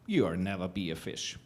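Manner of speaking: low, dropped-down fish voice; lightly voiced fish. Fish telling the creature it will never be one, low. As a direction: sink it low and final.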